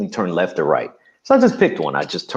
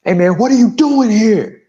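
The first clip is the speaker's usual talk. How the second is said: much louder and higher, imitating the shooter's yell; retold angry scream; 7 dB louder than usual. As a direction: yell it at the car window like the furious stranger. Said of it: "he screams at me".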